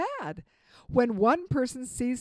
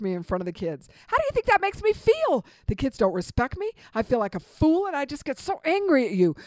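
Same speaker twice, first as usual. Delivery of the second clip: wrong way, angry; 5 dB louder than usual. Shown as a demonstration. Wife blaming husband Jerry for correcting her, louder angry accusing. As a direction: blame him hotly, voice rising and pushing. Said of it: "Here's the wrong way".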